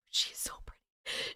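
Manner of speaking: barely audible whisper; very soft, voiceless gushing; awed whisper. Real, not acted. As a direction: whisper it in admiration. Gushing over a selfie photo.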